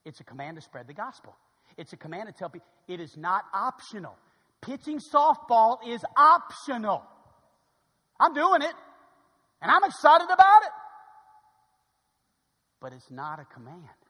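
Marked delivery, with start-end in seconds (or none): 4.6-10.7 loud, high declaration; excited shout. Real, not acted. Preacher telling church he loves softball, loud excited shout. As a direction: build to a joyful shout.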